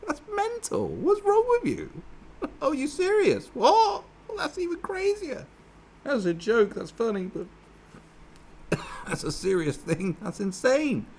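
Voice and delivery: very high, squeaky, incredulous outburst; very high, stunned reaction to a shocking ambition; shocked disbelief. Retold or imitated. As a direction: shriek your disbelief far up in the voice. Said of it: "burst out and go".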